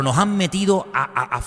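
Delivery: normal talking voice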